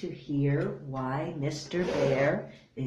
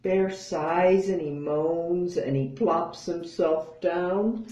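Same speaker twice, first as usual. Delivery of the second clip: usual speaking voice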